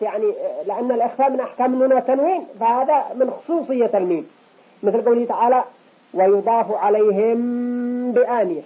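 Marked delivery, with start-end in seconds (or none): 6.1-8.6 slow tajweed reading of "ويضاف عليهم بآنية"; إخفاء شفوي; hidden mim. Shown as a demonstration. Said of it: "هكذا تقرأ".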